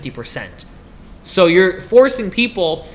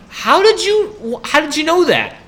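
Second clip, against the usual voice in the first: high-pitched incredulous questioning; acted-out disbelief, pitched way up; 8 semitones higher, slower than usual. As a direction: ask it drawn out and high, in mock disbelief. Acting out confrontation of nosy person.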